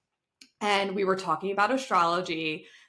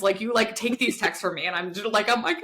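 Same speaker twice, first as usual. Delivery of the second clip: ordinary talk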